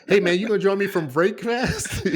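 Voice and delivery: laughing pretend buddy asking about break fast; mock casual invite. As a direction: ham up a friend's invitation while laughing. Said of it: "start calling it break fast".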